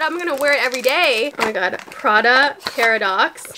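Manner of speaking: normal talking voice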